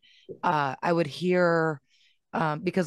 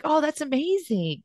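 put-on higher, bright wonder at a child's claim; mock amazement; 9 semitones higher than usual. Mom answering her son, high amazed tone. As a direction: gush like a delighted mom. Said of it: "I was like".

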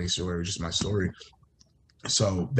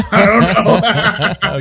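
breaking into loud high laughter; laughing; 12 semitones higher, 10 dB louder, much slower than usual. Real, not acted. Admitting he doesn't know King Arthur, laughing loudly. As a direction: laugh the words out, slowly. Laughs at his own gap.

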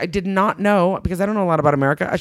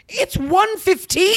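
high, slow incredulous quote; mock disbelief; 13 semitones higher, much slower than usual. Retelling seeing drunk girls at lunchtime, mock shocked. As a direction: stretch it out, acting aghast.

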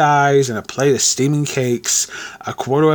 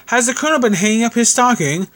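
put-on teasing young man; 10 semitones higher than usual. Tom teasing.

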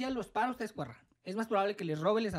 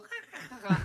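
mock laugh; 4 semitones higher, faster than usual. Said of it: "Y yo".

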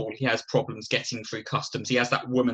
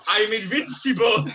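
high mock Russian accent while laughing; 8 semitones higher, 4 dB louder than usual. Quoting GoldenEye's Boris, laughing mock Russian accent. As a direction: ham up a Russian Bond villain, giggling. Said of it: "Boris Yeltsin cop from GoldenEye".

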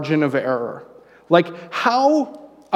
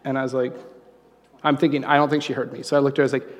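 normal talking voice

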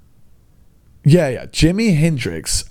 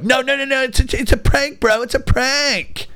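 mock prank bro; 12 semitones higher than usual. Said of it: "go ham on you and be like".

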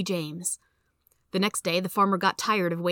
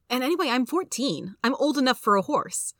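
put-on higher-pitched young boy protesting; defiant boy; 6 semitones higher than usual. A narrator's reading. Son insisting to his mother he is old enough, defiant. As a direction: argue back like a kid, pitched up.

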